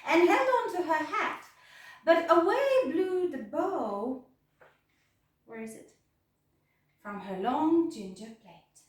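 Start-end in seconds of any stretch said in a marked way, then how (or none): none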